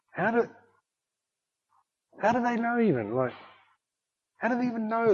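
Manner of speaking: high, soft bewilderment; baffled. Retold or imitated. Pastor recalling his disbelief at the surprise cheque, baffled. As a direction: puzzle aloud in a high, hushed voice.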